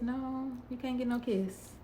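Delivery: high, teasing no; mock sweet refusal. Telling the date at the car he gets no kiss, teasing. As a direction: shut him down playfully.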